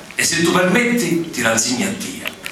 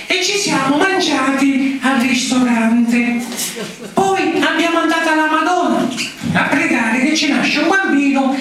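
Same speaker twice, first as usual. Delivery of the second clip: put-on child voice; 12 semitones higher than usual. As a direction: read it like a small pupil. Child reads school essay aloud.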